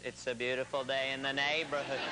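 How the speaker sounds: gentle children's host impression; Mister Rogers. Shown as a demonstration. Comic showing the first ingredient of his impression, children's host voice. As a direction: imitate a mild kiddie-show presenter. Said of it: "first you do Mister Rogers".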